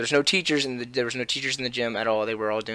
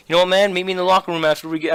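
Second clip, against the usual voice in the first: higher, louder retold challenge; mock tough kid calling someone out; challenging; 7 semitones higher, 5 dB louder, faster than usual. Kid challenges him to a locker room fight, challenging.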